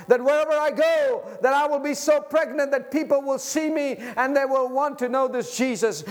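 loud, high preacher's cry; full-force preaching. Acted. Pastor urging the congregation to be full of Jesus, preaching loud. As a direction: preach it out to the whole church.

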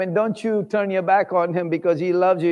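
ordinary talk

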